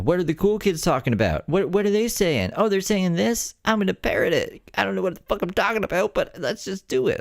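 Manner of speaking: high, airy mock of a clueless follower; mocking Brooke. Mocking Brooke as a clueless parrot, high and silly. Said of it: "So she's like".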